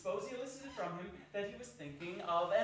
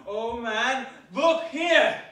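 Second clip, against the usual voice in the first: high, loud and urgent; urgent call; 4 semitones higher, 12 dB louder, much slower than usual. Acted. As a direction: demand that he look. Ghost reveals children.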